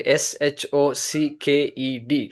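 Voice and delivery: S H O C K E D spelled letter by letter, slowly; slow deliberate spelling. Shown as a demonstration. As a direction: spell the word out to hammer it home.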